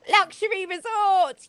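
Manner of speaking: jumps way up in pitch for mock delight; mock-gushing luxury resort; sarcastic reveal. Host sneering at the resort plan, mock delight.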